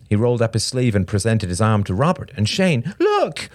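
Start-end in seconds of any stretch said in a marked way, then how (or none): 3.0-3.5 put-on very high child's yelp; squeaky insistent cry; upset little boy. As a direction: demand attention like a wronged child. Small boy shows his arm.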